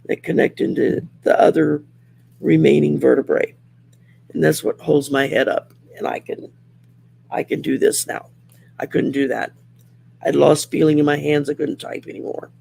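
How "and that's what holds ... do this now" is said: usual speaking voice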